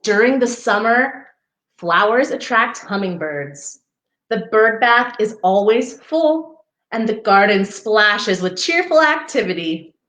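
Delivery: gentle storybook read-aloud; reading to children. Acted. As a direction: read it like a picture book for little ones. Reading picture book to children.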